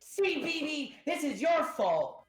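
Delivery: put-on high, loud accusing boy; shrieked childish accusation; young boy's voice. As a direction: blame her in a kid's yell. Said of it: "young boy in this crew".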